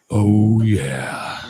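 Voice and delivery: deep low voice. Shown as a demonstration. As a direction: drop your voice down low. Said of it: "I got the highs and the lows".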